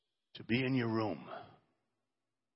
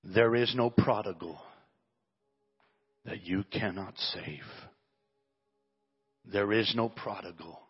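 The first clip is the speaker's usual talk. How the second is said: fervent prayer. Acted. Pastor praying over the church, fervent and building. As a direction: pour it out to God.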